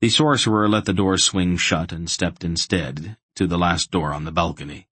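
ordinary delivery

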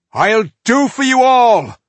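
slow, high, full-throated battle roar; war cry. Acted. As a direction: roar it as you charge. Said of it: "With a loud war cry".

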